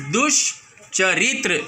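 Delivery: दुष्चरित्र said slowly, the half श light; slow demo word. Shown as a demonstration. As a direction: present दुष्चरित्र slowly to the class. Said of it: "दुष्चरित्र का उच्चारण किया". (दुष्चरित्र को धीरे, हल्के आधे श के साथ बोलिए)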